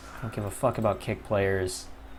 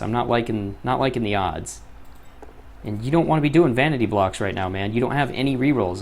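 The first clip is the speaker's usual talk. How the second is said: ordinary delivery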